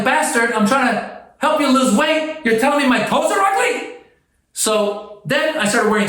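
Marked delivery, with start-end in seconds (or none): 0.0-4.0 much higher, indignant retort to the troll; hammed-up insulted rant; mock outrage. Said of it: "I'm like".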